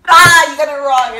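high, loud triumphant call; gleeful shout. Real, not acted. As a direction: crow that they got it wrong. Gleeful catch in quiz game.

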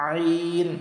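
"আইন" held from mid-throat; throaty ayn. Shown as a demonstration. Teacher modelling the letter, held throaty ayn. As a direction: squeeze the throat to open "আইন". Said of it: "হলকের মধ্য থেকে আমরা উচ্চারণ".

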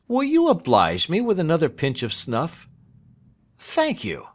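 high, pleased polite request; much relieved. A narrator's reading. Lawyer asking partner for snuff, much relieved. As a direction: ask brightly, with the worry gone. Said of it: "much relieved".

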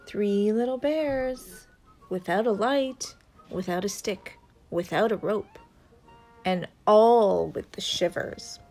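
ordinary delivery, nothing marked

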